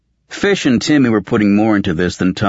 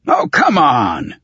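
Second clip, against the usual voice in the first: higher, drawn-out irritated snarl; 6 semitones higher, slower than usual. Acted. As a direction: snarl it in slow exasperation. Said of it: "Tully snarled".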